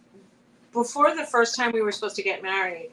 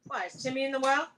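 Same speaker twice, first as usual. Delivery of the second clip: ordinary delivery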